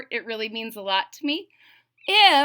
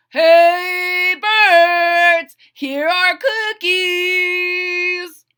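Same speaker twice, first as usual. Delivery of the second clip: much louder, higher, slow call; loud shout; 9 semitones higher, 11 dB louder, much slower than usual. Acted. Frog calling birds to come take cookies, loud shout. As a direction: call the birds from far away. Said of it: "He shouted in a loud voice".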